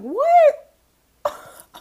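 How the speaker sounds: high, loud, drawn-out disbelief; hammed-up incredulous yelp. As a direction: squeal it in mock shock. Acts out her shocked reaction.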